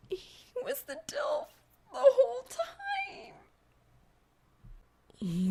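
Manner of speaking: high, breaking, half-laughing whisper; whimpering. Acted. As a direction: crumble into a whimpering, squeaky whisper. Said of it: "Whimpering, she crumbles".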